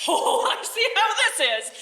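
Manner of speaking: put-on high, loud old woman, half laughing; shrill old lady. Old lady snaps back at the snake, high and loud. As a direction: squawk it like a caught old crone. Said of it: "And the old lady's like".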